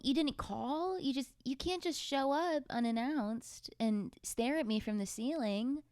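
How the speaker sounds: ordinary talk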